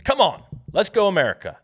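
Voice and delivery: a high, loud hammed-up cheer for America; mock rallying shout. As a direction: yell it like a fan in the stands, half joking. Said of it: "I'm just kidding".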